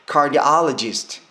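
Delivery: "cardiologist" very slow, loud and high on the "ah"; stress on O. Shown as a demonstration. Teacher naming the heart doctor, stressed O. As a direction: punch "AH" in "cardiologist". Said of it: "여기가 강세를 받는 부분입니다".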